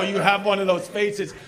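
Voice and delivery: building to a loud, high shout; hammed-up outraged yell; mock yell. Comic quotes himself to heckler.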